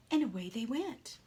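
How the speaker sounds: ordinary delivery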